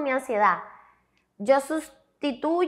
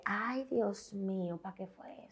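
heavy sigh; 4 semitones lower, 14 dB quieter than usual. Real, not acted. Recalling her breakdown.

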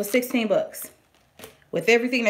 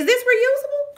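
normal talking voice

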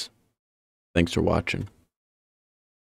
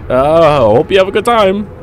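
scared horror game streamer; 9 semitones higher, 9 dB louder than usual. Real, not acted. Locked in, sarcastic panic.

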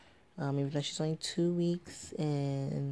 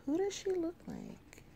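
very high, puzzled question; high wondering voice; 5 dB quieter than usual. Retold or imitated. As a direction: ask it up high, mock-puzzled. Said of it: "I'm like".

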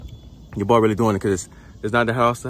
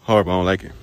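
ordinary talk, nothing marked

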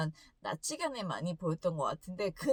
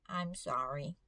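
small, lower, softer apology; boy meekly apologizing to a stranger he bumped, soft and lower; 6 semitones lower, 5 dB quieter, much slower than usual. Retold or imitated. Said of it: "너무 착하게 말을 잘 들으면서".

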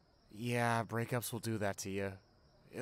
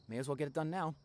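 usual speaking voice

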